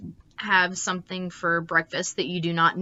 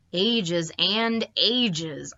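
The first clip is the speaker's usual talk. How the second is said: very slow, dragging each word; drawn out; much slower than usual. Acted. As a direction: stretch it out like endless waiting. Bored narrator drags it.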